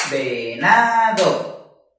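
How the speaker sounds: "Venado" very drawn out, ve, na, do; three voice beats. Shown as a demonstration. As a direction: stretch each syllable of "Venado" into its own beat. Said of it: "Lo dividimos en tres golpes de voz". ("Venado" muy lento, en tres golpes de voz)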